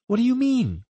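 high-pitched, puzzled question; puzzled woman. Acted. Woman presses nervous partner to explain, puzzled. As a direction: press for an answer, voice held high.

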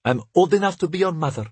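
high, quick, coy protest; simpering. A narrator's reading. Maid to Lev after his compliment, mildly simpering. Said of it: "she simpered just the same".

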